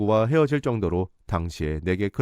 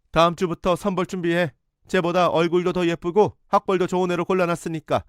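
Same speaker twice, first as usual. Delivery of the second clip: much higher, commanding; mother dictating her son's life, much higher and commanding; domineering mother; 8 semitones higher than usual. Retold or imitated. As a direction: bark orders like a controlling mother. Mother laying down the law.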